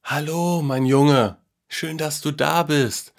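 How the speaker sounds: put-on high-pitched, drawn-out old grandpa voice; soft, tired greeting. A narrator's reading. Grandpa greets the boy from the living room, high and tired. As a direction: greet gently from the next room, a little weary. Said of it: "ertönte ein leises".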